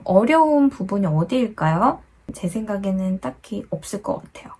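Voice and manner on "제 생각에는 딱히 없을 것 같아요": quick unvoiced whisper. Real, not acted. Teacher whispers an aside to students.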